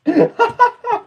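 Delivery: sudden high-pitched giggle; a squeaky burst of laughter at the joke. Real, not acted. Chat compares him to C-3PO, high giggle. Reacting to being called C-3PO.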